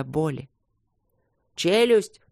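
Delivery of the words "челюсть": higher, sudden cry of warning; urgent shout. A narrator's reading. Someone in the fight warns the others, urgent shout. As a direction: call it out sharply as an alarm. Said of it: "крикнул кто-то".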